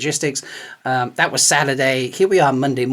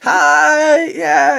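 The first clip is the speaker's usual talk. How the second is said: high, louder nervous greeting; squeaky starstruck hi; 12 semitones higher, 4 dB louder than usual. Retold or imitated. Meeting the actress, high starstruck squeak. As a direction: gush a nervous hi. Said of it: "if I actually said it like that".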